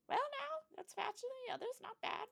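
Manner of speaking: put-on high, quavery old lady. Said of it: "I tried to do an old lady voice".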